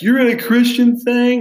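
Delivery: high, loud disbelief; as if he can't believe it; incredulous question. Retold or imitated. Friends react on phone.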